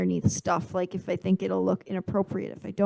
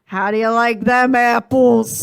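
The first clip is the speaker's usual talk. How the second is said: gloating Boston line; 10 dB louder, slower than usual. Retold or imitated. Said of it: "He said".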